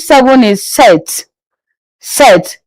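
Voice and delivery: sept said set with the P silent; French sept. Shown as a demonstration. Teacher modelling French sept for learners. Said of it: "You leave out the P".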